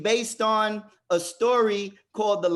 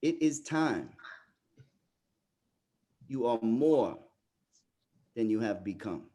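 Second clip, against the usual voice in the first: solemn quiet; 7 semitones lower, 4 dB quieter than usual. Real, not acted. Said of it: "repeats the line solemnly".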